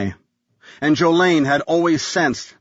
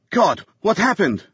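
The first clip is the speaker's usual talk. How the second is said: alarmed; 8 semitones higher than usual. Acted. Wife sees bleeding man.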